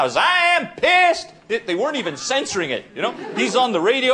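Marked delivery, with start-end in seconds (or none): 0.2-1.3 high, drawn-out mock fury; imitated furious outburst. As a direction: act out a mayor freaking out. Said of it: "he was freaking out".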